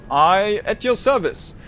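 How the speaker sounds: lightly voiced decadent socialite; suave host Mercurio. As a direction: welcome them like a smug party host. Mercurio bows.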